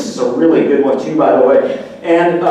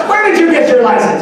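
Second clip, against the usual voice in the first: higher, louder mock shout at a driver; mock road rage yell; 9 semitones higher, 4 dB louder than usual. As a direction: yell at the driver who cut you off. Yelling at drivers mockingly.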